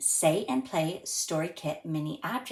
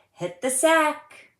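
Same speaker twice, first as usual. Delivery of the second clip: mock parental call, higher and louder; parents calling; 10 semitones higher, 8 dB louder, slower than usual. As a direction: call the kids to bed, playfully. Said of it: "till they say".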